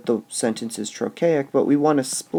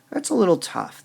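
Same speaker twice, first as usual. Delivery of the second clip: usual speaking voice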